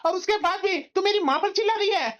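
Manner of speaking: high-pitched, indignant telling-off; angry scolding. Acted. Husband scolding wife for yelling at his mother, angry.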